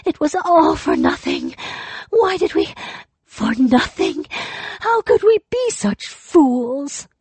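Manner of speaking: keen it out like a mourner; anguished lament. Acted. Despairing ghost woman laments.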